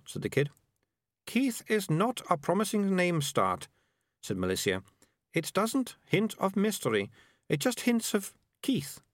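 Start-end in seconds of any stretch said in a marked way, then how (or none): 1.3-9.0 put-on higher, know-it-all young girl lecturing; a bossy girl explaining things; prim girl. Melissa judges the name.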